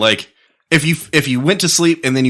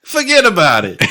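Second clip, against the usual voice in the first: higher-pitched, hammed-up New Yorker catchphrase; mock New York accent; 8 semitones higher, 4 dB louder than usual. Friend riffing on the Cuban joke, laughing mock New York accent. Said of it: "I'm from New York".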